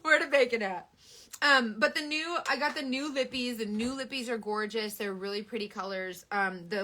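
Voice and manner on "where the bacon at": mock sniffing cheaters. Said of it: "guilty cheaters will be smelling".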